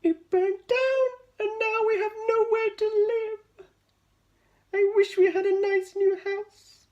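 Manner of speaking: very high, loud squeaky mouse; sad mouse. A narrator's reading. Mother mouse complains to George.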